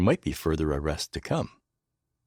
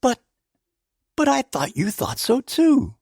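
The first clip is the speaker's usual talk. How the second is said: flustered; 4 dB louder than usual. A narrator's reading. Hastings flustered protest.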